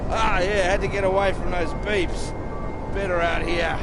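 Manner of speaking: very high, loud boozy holler; loud merry call from far away; tipsy calling out. Acted. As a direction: shout it back across the distance, half drunk.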